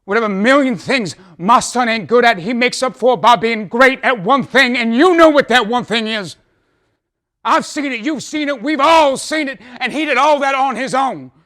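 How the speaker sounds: high and loud, swelling to a shout; impassioned outburst. Acted. Father boasting of his son and confessing his own failings, impassioned. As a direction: cry it out like a fervent testimony.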